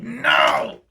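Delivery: hammed-up refusal; defiant. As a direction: play the rebel to the cop. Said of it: "I was like".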